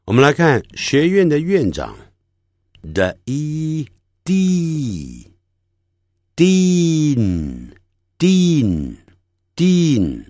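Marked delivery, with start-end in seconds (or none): 3.3-10.3 D and E sounded out, then long Dean; slow word demonstration. Shown as a demonstration. Teacher showing final N in Dean, very slow and drawn out. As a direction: stretch Dean very slowly, ending on a hummed N. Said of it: "这样就对了".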